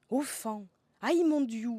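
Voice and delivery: high, loud burst of delight; excited exclamation. Real, not acted. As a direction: cry it out with glee. Delighted exclamation over favourite swearwords.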